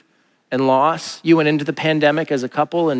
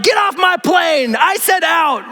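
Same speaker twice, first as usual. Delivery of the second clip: much higher, slow shouted order; mock action-hero shout; 14 semitones higher, slower than usual. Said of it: "I want him to just be like".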